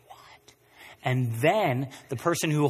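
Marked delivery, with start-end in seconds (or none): 0.1-0.5 breathless, whispered disbelief; stunned whisper. Pretend. Hearing the credit card debt is forgiven, a stunned whisper. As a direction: barely get the word out, dumbfounded.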